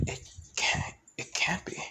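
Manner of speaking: breathless whisper of disbelief; stunned whisper. Acted. Izuku whispers in disbelief at a remembered voice, stunned whisper. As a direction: barely voice the words, shaken.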